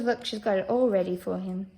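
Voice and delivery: usual speaking voice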